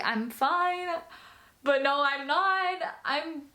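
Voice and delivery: imitating herself breaking down, pitch climbing; retold tearful whine. Daughter admitting to her mom she's not fine, retold tearful whine. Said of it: "I'm just, like, crying to her".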